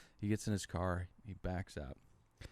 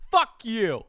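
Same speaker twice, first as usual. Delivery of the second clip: high, loud furious shout; sudden drunk outburst; angry drunk yell; 12 semitones higher, 13 dB louder than usual. Retold or imitated. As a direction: bark it at him like an angry drunk. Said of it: "he went".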